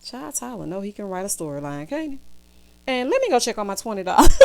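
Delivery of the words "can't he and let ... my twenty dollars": normal talking voice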